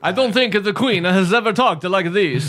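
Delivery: hammed-up Italian accent for the Queen; mock Italian accent. Co-host mocks the Queen in a put-on Italian accent. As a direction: talk with your hands, broad Italian accent. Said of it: "What accent is this?".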